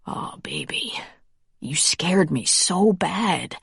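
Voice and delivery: slow, breathy whisper; quietly. A narrator's reading. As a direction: whisper it with relief. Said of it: "Then, more quietly".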